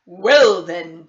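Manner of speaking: the doctor turning to the mother; booming doctor. Acted. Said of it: "Doctor Bumble said".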